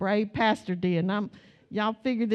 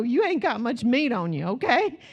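usual speaking voice

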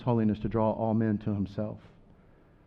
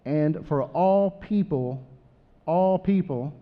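raised, emphatic Bible reading, slowed on all people; scripture reading; 4 semitones higher, 3 dB louder than usual. Acted. Pastor reads Thessalonians to the congregation, proclaiming. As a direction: read the verse as if proclaiming it.

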